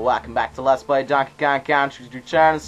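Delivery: loud hammed-up country accent. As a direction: belt out a cheery intro in a country twang. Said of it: "do a country voice".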